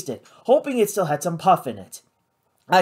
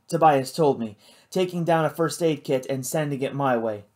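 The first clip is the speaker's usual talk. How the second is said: ordinary delivery, nothing marked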